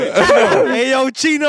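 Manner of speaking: high, loud call across the room; mock shout-out to Chino. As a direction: hail him from far away. Calling out to Chino.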